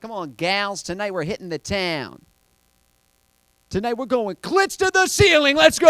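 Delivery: high mock-mom party cheer, building to a shout; imagined mom hyping friends. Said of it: "Never heard her going out with her friends like".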